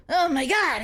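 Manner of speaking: plain reading voice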